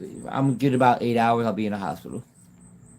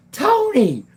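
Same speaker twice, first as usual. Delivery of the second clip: very high, loud holler; imitated high-pitched yell; shouted reply; 5 dB louder than usual. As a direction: yell the name back from far off. Answer in reenacted yelled exchange.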